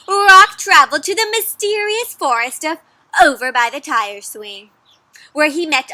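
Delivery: put-on reading to children, lifted and loud; dramatic narration. A narrator's reading. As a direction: announce the journey like a legend.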